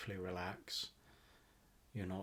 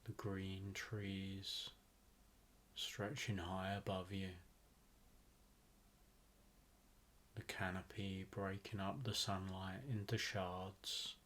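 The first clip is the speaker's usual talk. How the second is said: guided meditation; 3 dB quieter than usual. Acted. Host guiding listeners to relax into a rainforest visualization, guided meditation. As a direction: ease them into relaxation, phrase by unhurried phrase.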